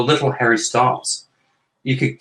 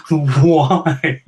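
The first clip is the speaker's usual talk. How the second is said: mock reader; 3 semitones higher than usual. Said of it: "as some people who've read my book say".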